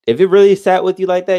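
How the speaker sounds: ordinary talk, nothing marked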